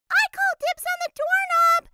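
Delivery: high, loud eager call. A narrator's reading. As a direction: shout it while running. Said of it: "called Emma as she ran".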